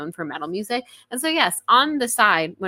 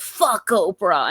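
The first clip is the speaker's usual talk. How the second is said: [name] voice; 5 dB louder, much slower than usual. Retold or imitated. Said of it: "and his, like, [name] voice".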